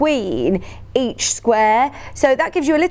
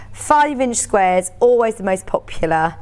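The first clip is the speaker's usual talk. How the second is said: ordinary talk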